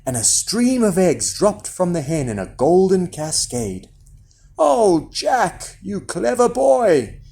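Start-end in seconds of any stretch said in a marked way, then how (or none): 4.6-7.2 high, delighted mother; proud mother overjoyed; exclaiming. A narrator's reading. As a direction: exclaim it with joy, pitched high. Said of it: "she exclaimed".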